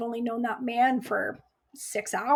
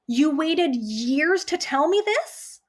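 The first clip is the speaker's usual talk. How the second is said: louder, higher and slow, furious disbelief; angry disbelief; 5 semitones higher, 5 dB louder, slower than usual. Retold or imitated. Nurse confronts the doctor who waited years to praise her, angry disbelief. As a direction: hurl it back at her, outraged. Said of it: "I was so mad".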